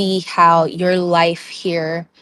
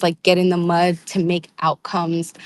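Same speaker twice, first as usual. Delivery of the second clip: ordinary delivery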